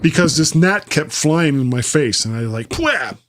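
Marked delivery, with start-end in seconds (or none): 2.7-3.2 high, sudden "pwah" noise; startled splutter. Retold or imitated. Host acts out batting off a gnat, startled splutter.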